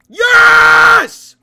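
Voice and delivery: big high victory yell; triumphant shout. Real, not acted. Gamer celebrating a kill with a huge yell. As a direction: scream it out in triumph. Winning a fight.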